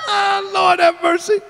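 high cry at the tail of a laugh; laughing exclamation. Real, not acted. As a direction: wail it, worn out from laughing. Recovering from laughing fit.

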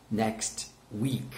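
"Next week" softly, in two separate words; model pronunciation. Shown as a demonstration. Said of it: "One more time".

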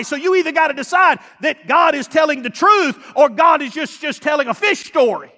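loud, high preaching shout; fiery pulpit preaching; full-force preaching. Acted. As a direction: build to a shouting climax.

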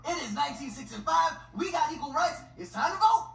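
growing excitement about voting; a hammed-up eager voter rising to a cheer.

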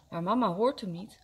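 ordinary delivery, nothing marked

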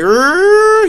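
high loud mock siren wail; siren imitation. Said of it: "you hear the sirens go off".

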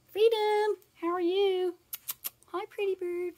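high-pitched coo to a pet bird; cooing pet talk. Real, not acted. Checking on her pet parrot, high cooing pet talk. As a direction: greet the parrot in a high, sweet sing-song.

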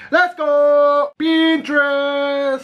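high, loud drawn-out hype; hype shout. Real, not acted. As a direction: stretch it out like a hype call.

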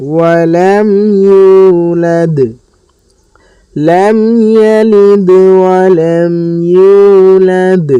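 slow melodic tajweed chant; measured Quranic recitation. Shown as a demonstration. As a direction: recite slowly, drawing out each vowel.

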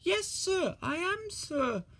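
high, muffled little voice. A narrator's reading. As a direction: answer from under the clothes. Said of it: "a muffled voice replied".

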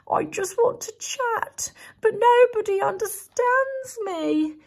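high, whimpering plea; lightly voiced crying bear; tearful bear. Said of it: "burst into tears".

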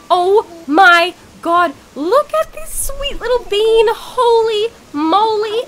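high, loud, gushing squeal over a cute villager; excited high squeal, slowed and loud. Real, not acted. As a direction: coo over the sweet little bean.

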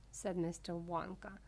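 ordinary delivery

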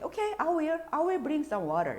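high "오케이", slow "I will bring some water"; slow demo of volunteering; quick volunteer answer. Shown as a demonstration. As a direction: answer on the spot. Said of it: "바로 즉석으로 대답할".

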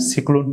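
"সিক্লোন" with "si" for "sai"; short i opening in "সিক্লোন"; mispronounced cyclone. Shown as a demonstration. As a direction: say cyclone wrongly as sik-lon. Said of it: "বলেছিলাম".

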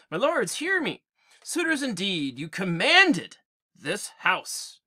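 queen rebuking the suitors, lightly voiced; a hint of a proud queen's command; reading Penelope's scolding with some force; Penelope addressing suitors.

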